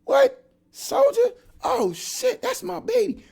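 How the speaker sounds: very high, loud shout of recognition; loud excited greeting. Retold or imitated. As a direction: yell it across the pod like seeing an old friend. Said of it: "This dude says".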